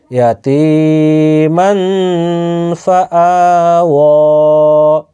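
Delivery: slow, melodic tajweed chant with long nasal ghunna; Quran recitation. Shown as a demonstration. Teacher recites tajweed examples for his students, slow melodic chant. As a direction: chant each word slowly, drawing out the nasal hum.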